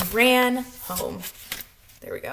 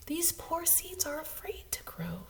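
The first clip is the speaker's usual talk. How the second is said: hushed, breathy whisper; 5 semitones higher, 12 dB quieter than usual. Acted. Frog hushing Toad about his scared seeds, whispered.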